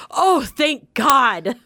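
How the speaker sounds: slow, hugely relieved "oh thank God" as her dad. Retold or imitated. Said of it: "you could just hear my dad go".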